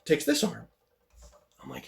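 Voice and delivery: normal talking voice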